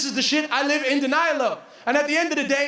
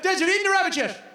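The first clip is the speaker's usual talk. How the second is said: teasing traveler; 5 semitones higher, faster than usual. Retold or imitated. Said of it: "Everyone I met".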